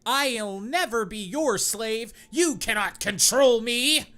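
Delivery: high, loud defiant yell; angry shout. Acted. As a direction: shout it at your captor, refusing. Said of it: "Optimus is very angry and shout to Jabba".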